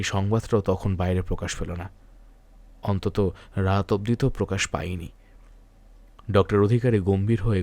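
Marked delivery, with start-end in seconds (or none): none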